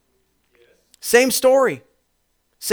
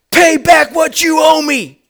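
slow, high shouted demand; angry demand; 5 semitones higher, 4 dB louder, slower than usual. Acted. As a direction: demand it through clenched rage. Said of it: "he demanded".

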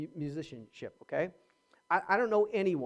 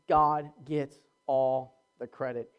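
normal talking voice

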